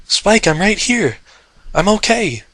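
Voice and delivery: high, cracking desperate call; voice breaking. Acted. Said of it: "her voice breaking".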